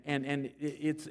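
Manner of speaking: ordinary delivery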